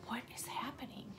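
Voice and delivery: unvoiced, frightened whisper. Recalling fear in the dark, whispered. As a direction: whisper it like you're scared in bed. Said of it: "I'm like".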